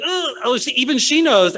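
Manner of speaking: high-pitched inner wail; dismayed realization. Retold or imitated. Applicant realizing the student noticed, high-pitched dismay. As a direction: pitch jumps up in mock dismay. His inner panic after suit remark.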